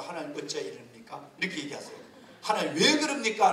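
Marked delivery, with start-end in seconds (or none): 0.0-1.3 low, hushed question to God; indirect, dignified complaint. Shown as a demonstration. Pastor modelling a polite complaint to God, restrained and quiet. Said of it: "직설적으로 표현하지 말고". 2.4-3.5 blunt complaint. Shown as a demonstration. Pastor showing how not to question God, blunt complaint. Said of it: "라고 하면 불평이 돼요".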